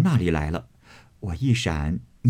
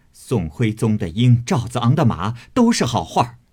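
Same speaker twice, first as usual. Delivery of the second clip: angry mockery; 3 semitones higher, 4 dB louder than usual. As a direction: sneer it out in anger. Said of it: "指着他骂道". (愤怒地讥讽)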